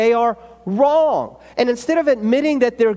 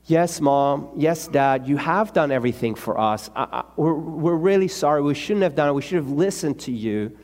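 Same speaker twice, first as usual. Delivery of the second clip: put-on meek child apology; 8 semitones lower than usual. Child's ideal apology to parents.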